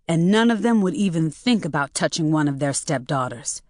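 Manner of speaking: ordinary delivery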